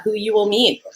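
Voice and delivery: normal talking voice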